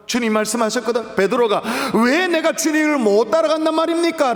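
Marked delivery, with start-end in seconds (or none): 1.9-4.3 protesting. Retold or imitated. Preacher voicing Peter protesting to Jesus, indignant. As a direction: push back hard, mock outrage. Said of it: "항의를 하는 거예요".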